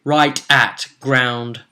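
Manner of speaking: grand story narrator, slowing toward the end; dramatic recap narration. A narrator's reading. Recapping the cursed party's peril, dramatic narration. Game recap scene setting.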